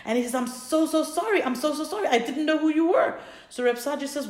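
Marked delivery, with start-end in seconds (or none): 0.3-3.2 high-pitched imitated pleading; tearful apology, retold. Innkeeper apologizing to the great rabbi, mock-tearful pleading. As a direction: plead like a distraught man, without real tears. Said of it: "he's crying and he's crying".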